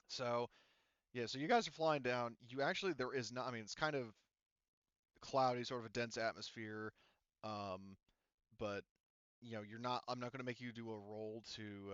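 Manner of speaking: normal talking voice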